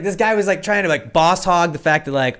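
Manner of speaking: ordinary talk, nothing marked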